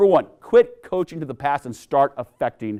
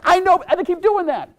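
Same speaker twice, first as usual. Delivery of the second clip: high exasperated voice; frustrated coach; 3 dB louder than usual. Retold or imitated. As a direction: throw up your hands.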